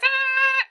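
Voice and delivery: high-pitched sustained skid squeal; imitated tire screech. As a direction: mimic tires screeching on the road, thin and high. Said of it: "all you hear is".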